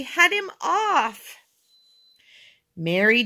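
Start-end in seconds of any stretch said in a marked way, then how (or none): 0.0-1.3 high, slow winded shout; drawn-out desperate order; panting. A narrator's reading. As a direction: call for help while out of breath. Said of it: "panted Henry".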